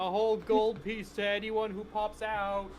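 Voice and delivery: high, drawn-out hollered offer; loud shout. Acted. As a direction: call slowly to people far off. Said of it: "Kothwood just very loudly shouts".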